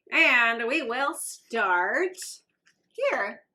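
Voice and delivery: ordinary talk, nothing marked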